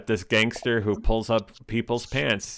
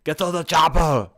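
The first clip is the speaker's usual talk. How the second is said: louder, higher Austrian movie-star bark; poor Schwarzenegger; 5 semitones higher, 7 dB louder, slower than usual. Retold or imitated. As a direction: shout it like a bad Arnold impression. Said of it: "I do a poor Schwarzenegger".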